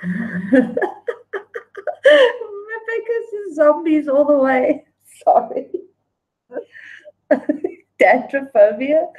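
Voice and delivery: talking through laughter, pitched high; laughing. Real, not acted. Host reading a player's silly answer, laughing. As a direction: crack up while reading it out.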